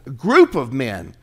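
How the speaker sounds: ordinary talk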